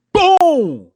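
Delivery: loud, high "boom" sliding down; big booming impact cry; shouted punch noise. Acted. Acts out a punch landing.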